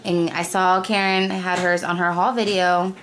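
normal talking voice

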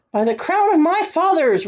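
high-pitched royal oath; mild roar. A narrator's reading. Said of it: "roared the king".

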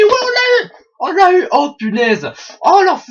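very high, loud victory yell; triumphant shout. Real, not acted. Player celebrating a kill, loud high cheer. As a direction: explode with joy at the kill.

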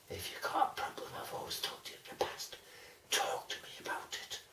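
unvoiced whisper to the dog. Real, not acted. Confiding to the dog away from her, whisper. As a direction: whisper it privately and close. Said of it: "whispering to the dog".

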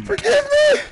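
very high, loud begging cry; mock anguished plea. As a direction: beg forgiveness in hammed-up despair. Begs the chicken's forgiveness.

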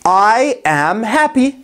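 slow, careful teacher's model sentence. Shown as a demonstration. Teacher models the sentence slowly for students. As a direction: spell each word out slowly. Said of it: "model sentence slow".

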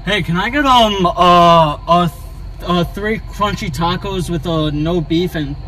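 ordinary talk, nothing marked

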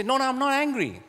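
high, defensive "I'm not angry"; high-pitched denial. Retold or imitated. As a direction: insist too brightly that you're fine.

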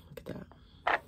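soft whispered look at that. Real, not acted. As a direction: breathe it out admiringly. Whispering at swatch.

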